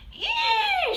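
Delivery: high, loud startled scream. A narrator's reading. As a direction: shriek in fright at a mouse. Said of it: "shouted Missus Newcomb".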